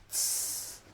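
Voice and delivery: short unvoiced hiss; snake hiss. Angry snake hisses after the thief, short hiss. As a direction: hiss angrily. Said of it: "sifflait le serpent".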